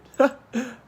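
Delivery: sudden high laugh breaking the tears; teary laugh. Real, not acted. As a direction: laugh it off mid-cry.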